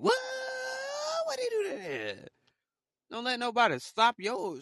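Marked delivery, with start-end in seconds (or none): 0.0-2.3 mock disbelief. Real, not acted. Incredulous squeal.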